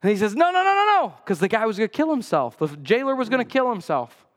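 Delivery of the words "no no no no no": high, loud pleading no's; urgent retold outcry; crying out no. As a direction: call out to stop him. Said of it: "Paul cries out of the darkness".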